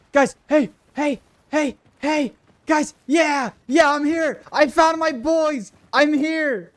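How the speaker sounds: high, loud excited shouts to teammates; calling out excitedly. Real, not acted. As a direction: yell it out, relieved to find them.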